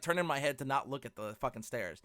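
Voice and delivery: ordinary talk, nothing marked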